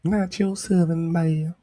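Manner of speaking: mock a genteel Southern Belle; high Southern Belle lilt, teasing a friend; Southern Belle accent. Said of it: "with the Southern Belle accent".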